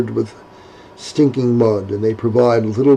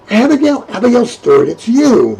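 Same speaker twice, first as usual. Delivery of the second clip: surprised recognition; 9 semitones higher, 3 dB louder than usual. Acted. Recognising muddy Abigail.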